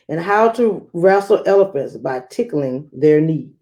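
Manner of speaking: put-on lively read-aloud for children; storybook reading voice; story narration. A narrator's reading. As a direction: read it like a picture book to a class.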